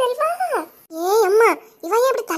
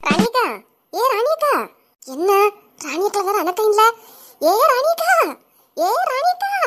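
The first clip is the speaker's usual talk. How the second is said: calling out; 3 semitones higher, 3 dB louder, slower than usual. Acted. Neighbour calling Ranika out of her house, loud call.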